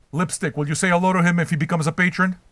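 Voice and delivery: usual speaking voice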